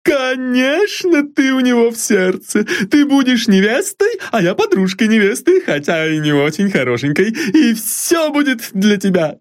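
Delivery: very high, doting servant cooing fondly; an old servant fussing over her mistress; high, fond, bustling maid voice; fond exclaim from old maid. A narrator's reading.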